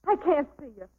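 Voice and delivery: high, loud, urgent pleading; distressed plea. Acted. Woman begging old boyfriend to leave, high distressed plea. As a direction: beg him to go, voice climbing. She sends him away, upset.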